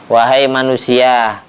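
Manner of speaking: ordinary delivery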